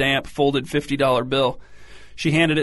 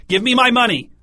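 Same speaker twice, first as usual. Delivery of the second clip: much higher, loud, shrieking demand; near shriek; 10 semitones higher, 6 dB louder than usual. Acted. Mother demanding her money back, near shriek. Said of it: "Her voice rose nearly to a shriek".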